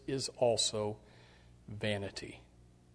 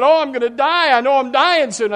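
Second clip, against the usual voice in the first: high, loud, despairing voice of the sick man; reenacted despair, much higher and louder; dying friend; 12 semitones higher, 14 dB louder than usual. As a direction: let his fear of dying ring out. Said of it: "he said".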